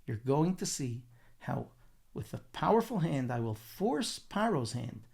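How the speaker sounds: low-key, measured promise with pauses; calm reassurance. Retold or imitated. Hashem answers Moshe's complaint, calm reassurance.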